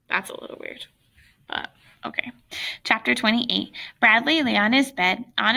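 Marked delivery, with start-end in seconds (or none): 0.1-2.3 quiet unvoiced aside to self; whispered aside. Real, not acted. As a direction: breathe it as a private comment.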